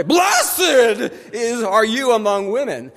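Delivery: shouted, high and loud; a pregnant woman's loud blessing; loud cry. Retold or imitated. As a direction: cry it out with full voice. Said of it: "she cries out in a loud voice".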